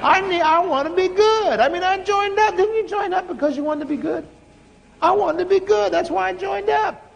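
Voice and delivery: high, exasperated whine; frustrated plea. Retold or imitated. Preacher retells complaining to God, high exasperated whine. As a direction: push back, fed up and pleading.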